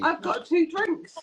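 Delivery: slow words through a laugh; giggling as she says it. Real, not acted. Laughing about holding two drinks.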